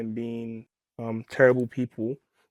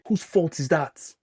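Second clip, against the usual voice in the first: scornful critic; 3 semitones higher, slower than usual. Retold or imitated. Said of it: "rolling her eyes and giving me".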